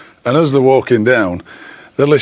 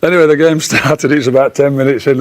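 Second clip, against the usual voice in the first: ordinary talk, nothing marked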